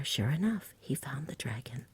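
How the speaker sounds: ordinary delivery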